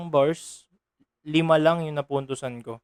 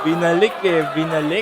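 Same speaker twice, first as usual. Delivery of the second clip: hyped; 8 dB louder than usual. Real, not acted. Hyped reaction to a rebuttal.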